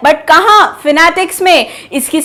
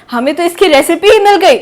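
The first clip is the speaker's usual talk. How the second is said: ordinary delivery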